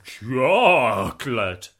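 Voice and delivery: loud, slow gleeful cry. Acted. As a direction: draw out chocolate with glee. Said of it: "he added with glee".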